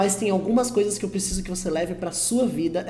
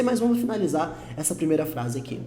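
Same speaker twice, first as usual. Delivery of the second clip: usual speaking voice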